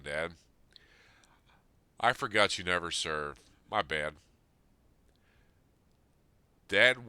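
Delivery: reading a heartfelt letter aloud to his dead father; earnest, rising and falling appeal, voice swelling on the calls; emotional letter reading. Acted. Son reading a letter to his absent father, pleading and earnest.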